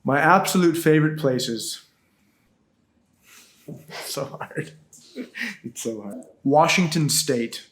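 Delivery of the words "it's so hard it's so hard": laughing. Real, not acted. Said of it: "laughing at hard question".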